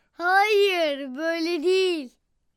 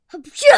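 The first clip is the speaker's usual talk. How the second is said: sneezing; 4 semitones lower, 6 dB quieter than usual. Acted. A sneeze in the scene.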